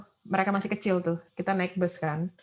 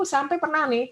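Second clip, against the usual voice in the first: ordinary talk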